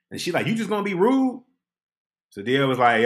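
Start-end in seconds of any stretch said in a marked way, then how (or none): 0.5-1.4 higher, indignant question; sassy scolding. Retold or imitated. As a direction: call him rude with attitude. Woman scolding.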